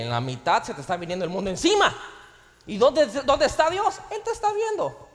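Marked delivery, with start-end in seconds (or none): 2.7-5.1 high, loud ringing question; rising preaching shout. Acted. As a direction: lift it up to the whole church. Preaching climax.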